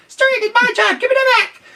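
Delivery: very high, loud squirrel voice; squeaky squirrel snatching back; high fast squirrel. A narrator's reading. Squirrel telling duck to give spoon back, squeaky. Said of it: "squirrels talk fast".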